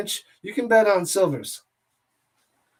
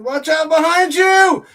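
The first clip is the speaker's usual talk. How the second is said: scream style; 9 semitones higher, 9 dB louder than usual. Real, not acted. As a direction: drag it out like yelling a warning at the player. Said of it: "I went all scream style".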